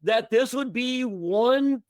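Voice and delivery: incredulous retort. Retold or imitated. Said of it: "And I was like".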